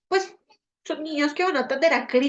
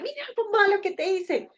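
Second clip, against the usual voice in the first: put-on high-pitched child proudly showing a gift; excited child; 8 semitones higher than usual. Child giving mom a Mother's Day present, excited child. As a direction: pipe up high like an eager kid.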